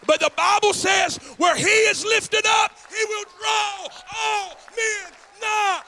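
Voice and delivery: full-force preaching. Real, not acted. Preacher closing the sermon to his congregation, full force. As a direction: drive the message home to the church.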